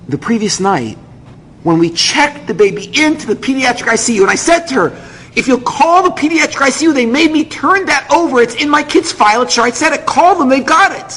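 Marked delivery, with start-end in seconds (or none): 5.3-11.1 high-pitched, insistent argument; urgent pleading. Retold or imitated. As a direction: argue desperately with an official. Desperate father arguing with clerk.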